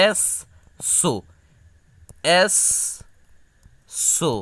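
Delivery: এস and সো spaced with long pauses; syllable by syllable. Shown as a demonstration. Teacher sounding out assonance. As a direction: say এস, pause, সো, slowly twice. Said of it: "এটাকে ভেঙে ভেঙে পড়বা".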